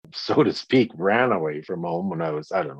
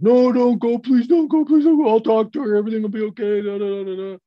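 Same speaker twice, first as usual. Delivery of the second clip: high pleading mock voice, much higher and louder; pleading Jason; 7 dB louder, faster than usual. Father imitating Jason begging them not to leave, high whiny plea. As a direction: whine like a desperate boyfriend begging them to stay.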